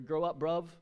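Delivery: punchy mock English slang voice; badly faked English accent. Preacher imitating how locals scold Joseph, bad English accent. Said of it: "I cannot do English accent".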